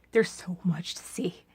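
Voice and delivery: "There's so much to see" breathed in a whisper; soft whispered repeat. Acted. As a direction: hush it like a secret.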